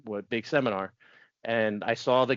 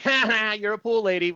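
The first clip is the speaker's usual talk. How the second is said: mocking; 9 semitones higher, 4 dB louder than usual. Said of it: "And I'm an asshole".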